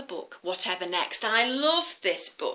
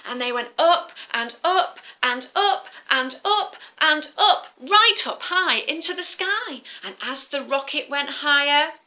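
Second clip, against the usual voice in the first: usual speaking voice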